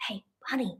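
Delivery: soft whisper to a sleeping partner; whispered wake-up. Retold or imitated. Waking sleeping husband Bunny at night, gentle whisper. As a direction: whisper his name to wake him.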